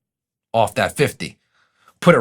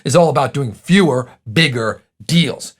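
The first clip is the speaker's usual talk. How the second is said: ordinary talk, nothing marked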